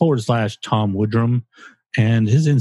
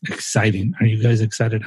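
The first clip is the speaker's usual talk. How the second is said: usual speaking voice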